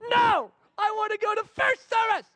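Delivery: put-on high, loud, shouted child voice; whiny child shout. Daughter to dad refusing again, high whiny shout. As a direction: yell it like a stubborn nine-year-old.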